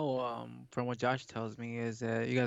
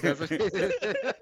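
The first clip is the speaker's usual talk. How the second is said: slow joke through laughter; laughing; 6 semitones higher, 5 dB louder, slower than usual. Real, not acted. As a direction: laugh it out slowly. Joke after innuendo, laughing.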